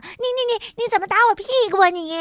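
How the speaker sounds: very high, tumbling fast sputter; spluttering protest. A narrator's reading. Frog hit on the bottom, spluttering in mock shock.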